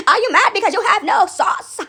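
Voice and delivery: loud, high playful jeering; mock taunt. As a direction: goad the haters loudly. Taunting critics.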